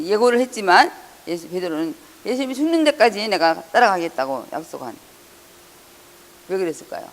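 normal talking voice

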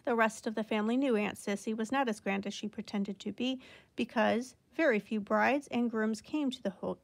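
ordinary delivery

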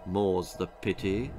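soft, low mutter to himself; muttered under breath. Acted. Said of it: "muttered under his breath".

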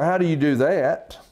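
ordinary delivery

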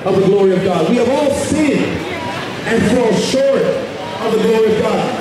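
ordinary talk

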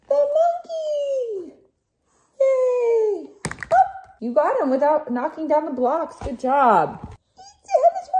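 high, excited playtime voice. Real, not acted. Playing with the baby.

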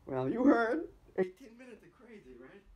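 loud, excited recap. Real, not acted. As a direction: burst out gleefully.

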